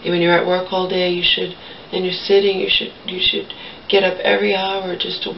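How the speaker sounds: ordinary talk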